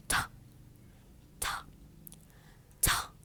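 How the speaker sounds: "ta" as a light puff of air. Shown as a demonstration. Teacher on standard RP pronunciation, airy T. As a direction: release "ta" with aspiration, little voice. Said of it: "just have to push the air".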